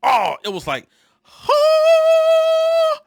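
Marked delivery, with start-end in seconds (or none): 1.2-3.0 very high, loud startled yelp; jolted out of his wits; stunned huh. Retold or imitated. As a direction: boss hit by a verbal earthquake. Boss stunned by the order.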